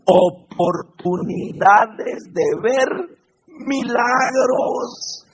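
slow, stretched words rising at the end; drawn-out emphasis. Real, not acted. Preacher telling Christians problems are opportunities, slow emphatic delivery. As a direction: savour each syllable of the promise. Preacher builds to key point.

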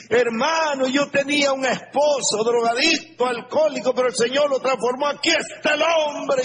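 high, fast, shouting with joy; a joyful churchgoer shouting her testimony; excited testimony. Retold or imitated. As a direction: proclaim the miracle at the top of the voice. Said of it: "Cuando oye decir".

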